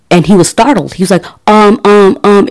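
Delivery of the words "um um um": nervous stammer on repeated ums; stuttering. Retold or imitated. Startled man stammering at the armed neighbor. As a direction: stammer out the ums, caught off guard. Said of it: "and he was stuttering".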